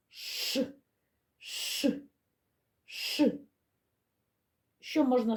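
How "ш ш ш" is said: Ш said alone as a hush, three times; isolated SH sound. Shown as a demonstration. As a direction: separate hushing "Ш" sounds with pauses between. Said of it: "Давайте його вимовимо".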